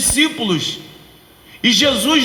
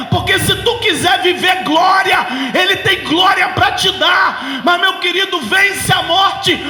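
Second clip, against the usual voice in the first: fiery preaching; 7 semitones higher than usual. Acted. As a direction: preach it at full force. Preaching at full force.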